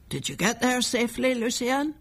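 a hint of an old man asking without looking up. Old man greets expected visitor.

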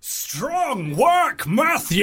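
high, stretched-out imitation of a station ident; mock voiceover voice. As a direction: echo the caller's showy announcer cry. Echoing the caller's shouted voiceover.

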